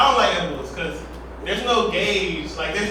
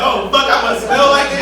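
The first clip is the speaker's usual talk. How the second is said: mock panic; 6 semitones higher, 8 dB louder than usual. Panicked stoner quote.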